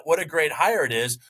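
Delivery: normal talking voice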